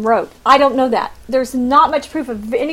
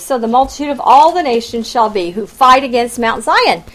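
normal talking voice

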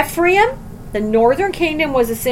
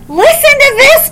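much higher and louder, a divine summons; God's commanding call; 14 semitones higher, 9 dB louder than usual. Acted. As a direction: call out as God demanding attention. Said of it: "God's saying".

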